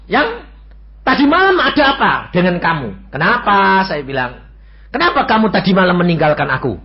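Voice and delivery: high, loud worried questions; wife on the phone, worried and loud; alarmed wife. Retold or imitated. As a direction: demand answers, anxious.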